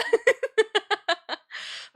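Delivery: teary squeaky laugh; a high, crying-edged giggle; high helpless laugh. Real, not acted. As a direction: laugh through the frustration. Laughing after outburst.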